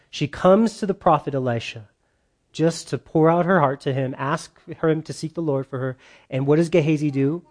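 ordinary talk, nothing marked

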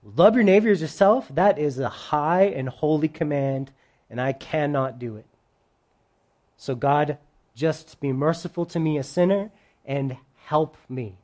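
sinking to a soft, low plea; humble prayer. Acted. Humility asks God for mercy, humbly. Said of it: "Versus humility".

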